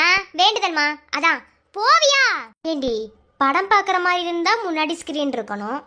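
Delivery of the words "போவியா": sharp shout. Acted. Shooing the daughter away.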